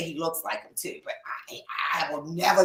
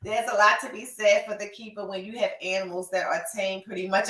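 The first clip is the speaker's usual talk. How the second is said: usual speaking voice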